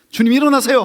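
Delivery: high, loud urgent call to wake him; urgent shout. Retold or imitated. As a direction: shout it in panic. Disciples wake Jesus in storm. (폭풍 속 제자들이 주님을 깨우며 다급하게 외침)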